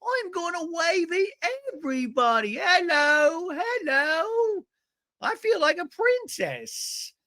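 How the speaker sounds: high-pitched hammed-up British accent; mock British princess, high and drawn-out; [name]'s British accent. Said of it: "[name] is doing her, uh, British accent".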